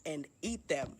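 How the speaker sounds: slow, deliberate mock threat; retold blurted threat. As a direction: space the words out, retelling it. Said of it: "I say out loud".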